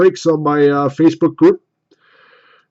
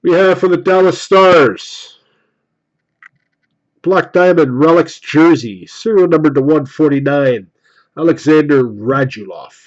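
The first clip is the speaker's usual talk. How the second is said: card reveal announcer; 2 semitones higher, 7 dB louder than usual. Acted. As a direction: present each card like a prize. Announces pulled cards like host.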